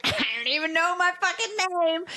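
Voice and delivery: mock frantic outburst, pitched high; thin, strained, high-pitched overwhelm; frazzled overwhelm. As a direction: replay the panic of being overstimulated. Overwhelmed, retelling own breakdown.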